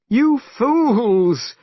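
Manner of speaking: high, loud scolding shout; angry cry. A narrator's reading. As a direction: scold the foolish followers. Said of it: "cried Tripitaka".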